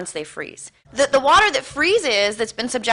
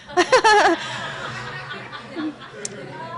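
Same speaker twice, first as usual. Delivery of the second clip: long laugh trailing off; 5 semitones higher than usual. Real, not acted. Speaker amused by blaming the emotional guy, real laugh. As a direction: burst out laughing, then let it fade.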